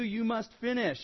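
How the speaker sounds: normal talking voice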